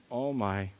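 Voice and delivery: hushed, despairing self-talk; quiet despair. Retold or imitated. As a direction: sigh it out to yourself, defeated. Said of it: "I said".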